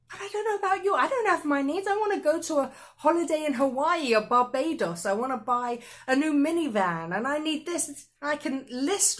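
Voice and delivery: loud, high-pitched comic protest; mock complaint. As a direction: rattle off wants like an indignant, hammed-up wish list. Mock complaint listing her wants.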